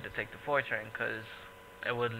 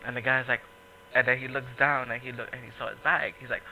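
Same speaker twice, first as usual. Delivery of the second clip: ordinary talk, nothing marked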